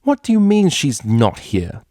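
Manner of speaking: high, loud, slow displeasure; an elegant mare seething; angry demand. A narrator's reading. Elegant mare scolding her lackey, high and angry. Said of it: "made her extreme displeasure known".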